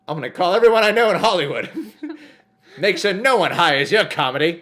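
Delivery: high, loud laughing threat; mock threat. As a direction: playfully threaten her career.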